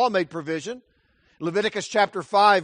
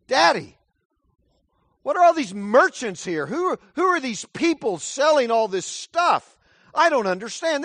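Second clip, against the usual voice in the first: put-on high, puzzled child's voice; a kid who can't make sense of it; child asking dad about the merchants, puzzled; confused child; 7 semitones higher, 4 dB louder than usual. Child questioning father in temple.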